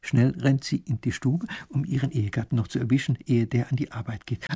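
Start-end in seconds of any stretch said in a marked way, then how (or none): none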